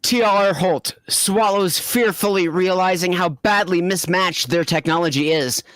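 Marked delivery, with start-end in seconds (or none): none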